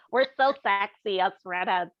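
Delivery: ordinary talk, nothing marked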